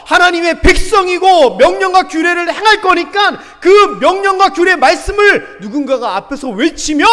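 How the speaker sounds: loud, high, driving proclamation; forceful preaching. Acted. Moses charging the people of God, forcefully proclaimed. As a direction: proclaim it at full force to a crowd.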